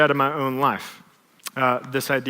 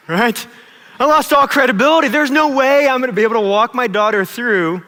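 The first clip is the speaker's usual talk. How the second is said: loud, high-pitched admission of defeat; raised, emphatic voice of someone caught out; exasperated exclamation; 6 semitones higher, 6 dB louder than usual. Real, not acted. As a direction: burst out loudly in exasperation. Exclaims after being caught out.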